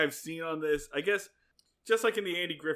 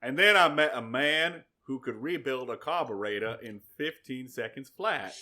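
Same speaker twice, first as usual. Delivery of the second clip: Forrest Gump; 3 semitones lower than usual. Acted. Host telling of meeting Gomer, botched Southern movie impression. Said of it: "I'm doing less Forrest Gump, and I'm doing more".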